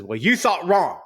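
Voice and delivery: sharp, loud, high comeback; irate retort. Retold or imitated. Retelling Ted's outburst at his boss, angry and loud. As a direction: snap back at her in anger. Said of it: "He is irate".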